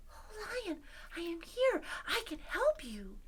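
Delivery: small high mouse voice; helpful mouse. Acted. Mouse finds trapped lion and offers help, helpful mouse. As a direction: pipe up high and gentle. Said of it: "He says".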